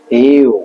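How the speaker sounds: slow "ইউ" stretched with a rise and fall; long U glide. Shown as a demonstration. Teacher showing how the letter U goes, elongated "ইউ". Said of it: "এভাবে একটু".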